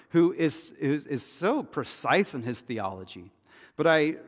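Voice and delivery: normal talking voice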